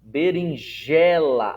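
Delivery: Berinjela slowed, JE loud and long; stress on penultimate syllable. Shown as a demonstration. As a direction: punch and hold the JE in Berinjela. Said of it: "o acento tônico recai na penúltima sílaba".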